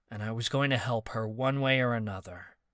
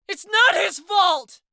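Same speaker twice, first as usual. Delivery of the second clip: much higher, louder slow yell; 10 dB louder, slower than usual. Acted. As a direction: yell to defend him. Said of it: "Callie yelled".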